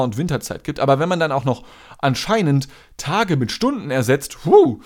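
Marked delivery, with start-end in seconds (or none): 4.5-4.8 high, loud disbelieving huh; a sharp gasp of disbelief; incredulous huh. Real, not acted. Reacts to days swapped for hours.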